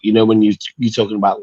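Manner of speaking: ordinary delivery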